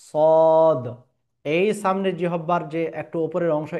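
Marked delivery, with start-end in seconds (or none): 0.0-0.9 loud thick সদ; মোটা সদ; heavy sad. Shown as a demonstration. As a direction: punch a heavy সদ. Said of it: "তারপরের বর্ণমালা দেখুন সদ".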